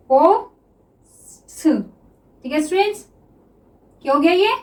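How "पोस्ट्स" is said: stretched very slowly while writing; drawn-out word. Shown as a demonstration. Teacher writing shorthand for students, word stretched slowly. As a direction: draw the word out as you write it. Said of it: "teacher sounds the word out while writing".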